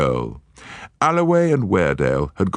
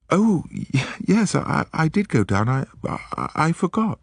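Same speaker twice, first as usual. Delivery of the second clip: a lightly voiced, higher, stammering Lady Juliet; flustered lady; 12 semitones higher than usual. Lady Juliet caught in a lie, faltering and higher. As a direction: caught out, stumbling on every word.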